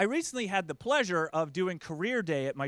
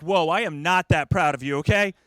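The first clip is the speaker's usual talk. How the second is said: louder, emphatic backing off; mock protest; 3 semitones lower, 9 dB louder than usual. Dad refuses daughter's dinner request, loud mock protest. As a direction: put the brakes on, loudly.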